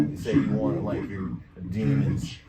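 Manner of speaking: deep, loud demon voice with echo; menacing low echoing threat; echo effect. Shown as a demonstration. Presenter demonstrating echo as demons threatening souls, deep echoing demon voice. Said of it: "we got some echo".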